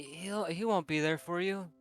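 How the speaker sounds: usual speaking voice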